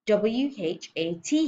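slow, mildly squealed letters. A narrator's reading. Boy held up by his ears spells, mild squeal. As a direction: strain out each letter slowly. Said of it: "he squealed".

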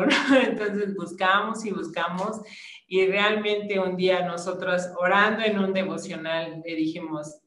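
usual speaking voice

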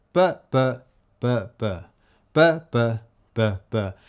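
da-da beats tapping out "But, but"; rhythm beat. Shown as a demonstration. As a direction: tap the two-syllable rhythm. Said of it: "Simple because it goes".

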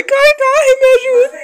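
high panicked shrieking; being chased. Real, not acted. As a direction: scream while running away. Chased by animal, cries for help.

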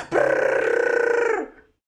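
loud high trilled drill noise; mouth-made power drill racket; imitated drill. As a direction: buzz like a drill with a rolling trill. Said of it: "no puedo hacer ruido".

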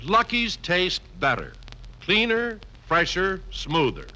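ordinary delivery

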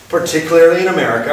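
ordinary talk, nothing marked

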